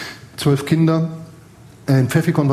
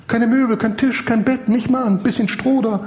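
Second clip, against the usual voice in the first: higher-pitched incredulous listing; retold astonishment, pitched up; mock indignation; 7 semitones higher than usual. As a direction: list what's missing in raised disbelief. Incredulous list of missing furniture.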